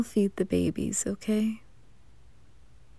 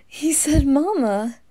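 laughing; 6 semitones higher, 5 dB louder, slower than usual. Acted. Amused disbelief.

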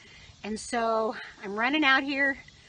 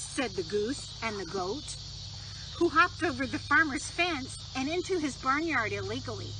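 gentle storybook reading voice; reading a story to children; 3 semitones higher, 3 dB quieter than usual. Acted. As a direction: read it like bedtime story time.

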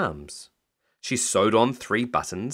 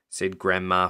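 ordinary delivery

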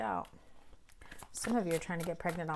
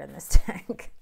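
loud, fast laughing aside; 4 semitones lower, 19 dB louder than usual. Real, not acted. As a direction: laugh it out, delighted. Laughing over favorite card.

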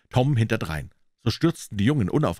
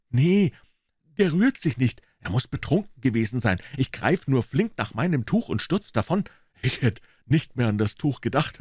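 put-on high, louder lively boy voice; boyish Tom; 6 semitones higher, 3 dB louder than usual. Tom telling Huck about the towel, lively boyish voice. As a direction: brag a little, relieved.

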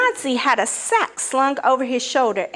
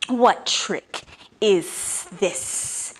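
hissed; much slower than usual. Acted. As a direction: hiss it slowly and menacingly. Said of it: "he hissed".

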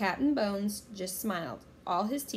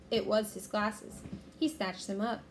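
ordinary delivery, nothing marked

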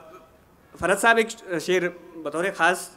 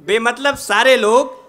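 measured, projected ghazal recitation with pauses; poetry recitation; 4 semitones higher, 8 dB louder than usual. Acted. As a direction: recite the couplet to a mushaira audience.

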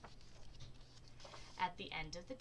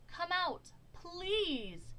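high-pitched pleading; storybook mayor imploring; begging; 9 semitones higher, much slower than usual. A narrator's reading. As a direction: beg with a drawn-out please. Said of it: "begged the groundhog".

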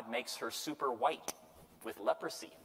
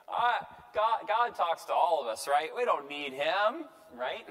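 high, loud mock grumbling; hammed-up complaint, stretched out at the end; complaining siblings; 4 semitones higher, 5 dB louder than usual. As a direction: protest like an indignant sibling. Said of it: "siblings now are complaining".

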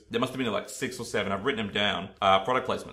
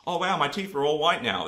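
hammed-up ad actor; 6 semitones higher than usual. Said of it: "ham it up and be like".

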